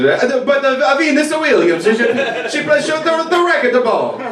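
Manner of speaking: high, hammed-up Italian man voice; mock Italian man fumbling about sports; Italian accent. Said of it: "sound like an Italian man who's lying".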